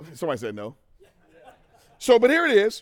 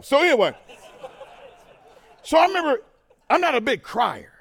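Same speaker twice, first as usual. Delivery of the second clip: laughing; 10 semitones higher, 7 dB louder than usual. Real, not acted.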